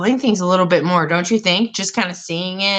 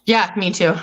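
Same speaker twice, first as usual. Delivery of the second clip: ordinary talk, nothing marked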